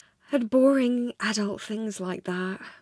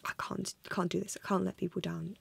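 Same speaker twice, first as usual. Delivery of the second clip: softer, hushed and anxious; anxious inner whisper; 7 dB quieter than usual. Retold or imitated. Vlogger panicking to herself about letting people down, hushed. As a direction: whisper it to yourself like a worried vlogger. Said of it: "I'm like".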